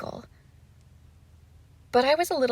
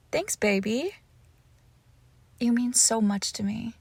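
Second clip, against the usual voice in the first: ordinary talk, nothing marked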